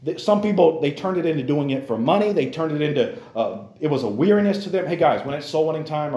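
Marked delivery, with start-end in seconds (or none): none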